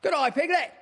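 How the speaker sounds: high, eager squeaky greeting; squeaky little Roo. A narrator's reading. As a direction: squeak it out like an excited little kangaroo. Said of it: "he squeaked".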